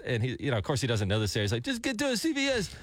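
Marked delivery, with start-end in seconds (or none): 1.6-2.7 high, imitated non-American accent, scammer on the phone. Host imitating the scam caller's non-American accent on air. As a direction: mimic the scammer's foreign accent, pitched up. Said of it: "he doesn't have an Americanized accent".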